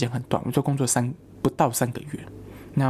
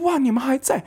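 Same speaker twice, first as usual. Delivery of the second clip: surprised; 12 semitones higher than usual. Retold or imitated. To former coworkers still at the store, high surprised delight. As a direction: gasp with glee at seeing old friends.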